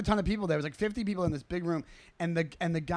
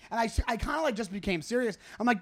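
ordinary talk

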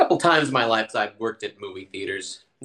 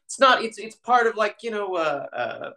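higher, hesitant voice of clueless coworkers; mock fumbling coworkers; 9 semitones higher than usual. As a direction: play them groping for the word. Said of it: "some of the people were like".

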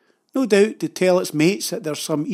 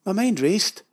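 ordinary delivery

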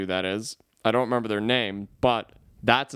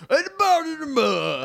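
much higher, loud wailing Italian guy; mock Italian American accent; 14 semitones higher, 4 dB louder than usual. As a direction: wail it like a hammed-up Italian American. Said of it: "they're just like".

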